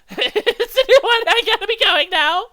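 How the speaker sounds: nervous laugh. Acted. Host laughing nervously while faking a beeper call. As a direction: fake a laugh and back away.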